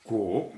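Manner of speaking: "Guo" with a rising tone; firm upward sweep on "Guo"; second tone. Shown as a demonstration. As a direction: say "Guo" in Mandarin second tone, pitch lifting at the end. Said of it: "this is second tone".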